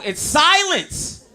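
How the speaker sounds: high, drawn-out "silence" with a laugh; slow punchline reveal. Real, not acted. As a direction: land the punchline slow and loud.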